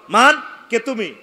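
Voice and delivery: loud, high gatekeeper's demand; shouted challenge. Acted. Ismail the gatekeeper challenging Jibril, loud demand. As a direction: call it out like a guard at a gate.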